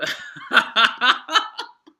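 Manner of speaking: high, loud burst of laughter; helpless high cackling. Real, not acted. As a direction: crack up loudly. Amused by the copied cat.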